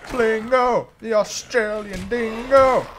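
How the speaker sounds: high, drawn-out hero announcement; mock superhero announcer. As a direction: announce a cartoon hero's name with flourish. Announces made-up superhero name.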